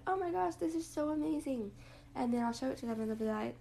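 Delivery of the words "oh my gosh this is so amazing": gushing excitement. Retold or imitated. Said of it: "I'd be like".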